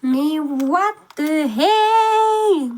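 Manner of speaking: drawn-out stunned what the hell; slow disbelieving exclamation. Real, not acted. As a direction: stretch it out, baffled. Stunned reaction.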